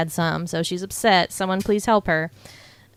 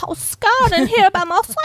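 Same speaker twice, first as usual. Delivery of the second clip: high, hammed-up fake French accent; botched French accent that slides into Western; bad French accent; 12 semitones higher, 4 dB louder than usual. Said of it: "bullshit French accent".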